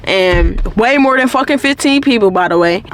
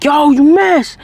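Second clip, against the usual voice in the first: higher-pitched mock call-out; mild mock yell, pitched up; imitated distant yell; 6 semitones higher, slower than usual. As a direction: imitate someone calling from across the street. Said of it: "not mumbles, but I hear".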